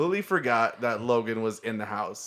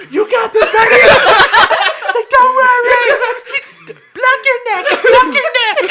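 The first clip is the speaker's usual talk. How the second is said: high, very loud mock-panicked shouting; frantic hammed-up coaching through laughter; [name] panicking; 13 dB louder than usual. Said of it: "this video of [name] panicking".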